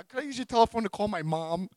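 high, timid pleading voice; meek request. Retold or imitated. Released man asks jail guy for phone, meekly. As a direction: ask small and high, like a kid.

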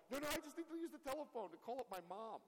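high pleading retold voice; sheepish mock plea. To the sergeant, asking to call mom, high and sheepish. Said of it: "And I'm like".

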